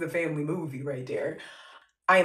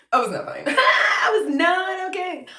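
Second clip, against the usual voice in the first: high, loud confession through laughter; laughing admission; 12 semitones higher, 7 dB louder than usual. Real, not acted. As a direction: crack up while admitting the truth. Laughing at her own false front.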